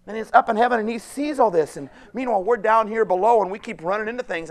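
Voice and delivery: loud, driving sermon voice; fervent pulpit delivery; preaching at full force. Real, not acted. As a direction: preach it out to the congregation.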